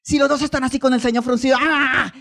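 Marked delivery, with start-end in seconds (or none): 1.6-2.1 drawn-out imitated quarrel cry; mock angry yell. Preacher imitating a frowning, fighting couple, mock angry yell. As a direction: let out a mock fighting "aah".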